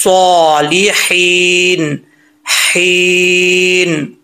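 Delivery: slow, elongated Arabic recitation; read-along recitation. Shown as a demonstration. Teacher leading students to read along, slow measured recitation. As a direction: stretch each long vowel, pausing between phrases. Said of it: "अब आपने मेरे साथ पढ़ना है".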